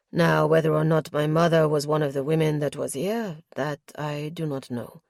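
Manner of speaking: a lightly deepened nobleman reporting calmly; lightly voiced lord, measured and guarded; Justin's voice. Justin's voice in dialogue.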